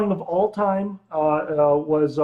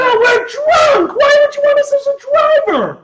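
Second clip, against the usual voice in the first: put-on wailing drunk; 9 dB louder than usual. Co-host answers the Uber driver request in a drunken wail.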